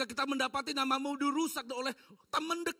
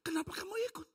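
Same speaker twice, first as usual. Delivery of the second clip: pleading whisper; 10 semitones higher, faster than usual. Acted. God's pleading question.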